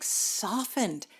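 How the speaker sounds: normal talking voice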